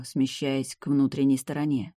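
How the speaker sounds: plain reading voice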